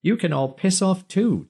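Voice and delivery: put-on high, tart old woman's voice; brassy old lady. A narrator's reading. Dinah snapping at the laughing crowd, tart high voice.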